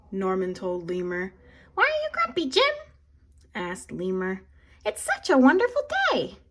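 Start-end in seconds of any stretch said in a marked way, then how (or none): 1.8-2.7 high, squeaky little lemur; high-pitched lemur voice asking Jim; Lemur's voice. A narrator's reading. 4.8-6.4 high and fast cheerful lemur; bright high lemur voice; Lemur's voice. A narrator's reading.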